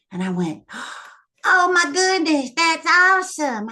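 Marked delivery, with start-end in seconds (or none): 0.7-3.7 gasp, then high, loud thrilled cry; excited gasp. Retold or imitated. Girl hearing she'll get a baby brother, thrilled gasp and cry. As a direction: burst with delight at the news. Retold delight at baby news.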